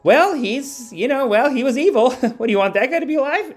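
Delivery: high, mocking put-on voice; mocking naive people. Streamer mocking people who excuse a killing, high and mocking. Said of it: "some people that are like".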